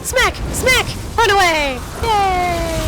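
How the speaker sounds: high, loud gleeful smack calls; hammed-up battle cry. Gamer acting out her gleeful fight, hammed-up cheers. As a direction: shout each hit like a cartoon brawl. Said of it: "I'm like".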